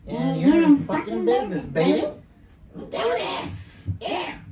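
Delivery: high, loud pitch-shifted hype. Shown as a demonstration. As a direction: shout through a pitch-up effect, pumped up. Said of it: "you can pitch down all you can change this one".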